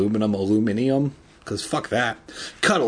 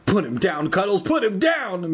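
raised, urgent pitch, little volume; mock scream; 7 semitones higher than usual. A narrator's reading. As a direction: build to a high-pitched frantic order. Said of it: "Mister Murphy screamed".

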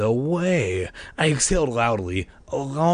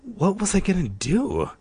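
ordinary delivery, nothing marked

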